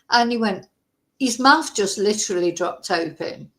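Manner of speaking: ordinary talk, nothing marked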